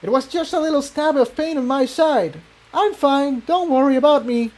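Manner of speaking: light pony voice, playing down pain; young mare Incendia. A narrator's reading. Incendia reassures Jigsaw she is fine, high and soft.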